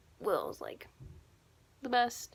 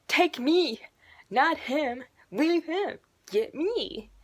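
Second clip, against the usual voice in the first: higher, insistent mock begging; dramatic pleading; 6 semitones higher, 4 dB louder, much slower than usual. Fan begging the monster to spare the boy, dramatic pleading. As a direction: beg to be taken in his place.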